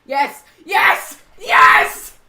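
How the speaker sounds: ecstatic screaming yes; shrieking jackpot winner. Real, not acted. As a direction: scream with pure joy. Engines shut down, cheering.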